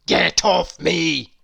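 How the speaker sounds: loud, slow, growled shout; Mister Grumpy shouting get off me; gruff shout. A narrator's reading. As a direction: bark it at her to back off. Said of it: "shouted Mister Grumpy".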